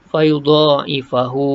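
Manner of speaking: fayudha'ifahu slow with a two-count hu; joined reading. Shown as a demonstration. Teacher showing the madd silah in recitation. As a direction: draw the final hu out for two beats. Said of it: "ha, dua harakat".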